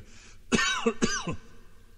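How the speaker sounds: rough hacking cough; harsh cough. Real, not acted. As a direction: cough hard from a sore throat. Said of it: "my throat is really getting nasty".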